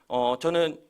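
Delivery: ordinary talk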